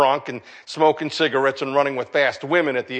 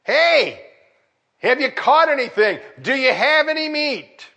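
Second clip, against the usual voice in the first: yelling from shore; 8 semitones higher than usual. Pretend. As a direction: shout it from the beach to the boat. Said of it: "some guy yells from the shore".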